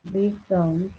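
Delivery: slow, measured read-out for note-takers; dictation. Real, not acted. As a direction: dictate word by word, leaving long gaps. Dictates notes while writing.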